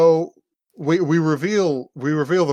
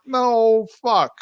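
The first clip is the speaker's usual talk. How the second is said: high, drawn-out retort; mock exasperation; 7 semitones higher than usual. Retold sibling quarrel, high mock exasperation. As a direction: groan it out high.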